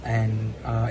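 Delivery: normal talking voice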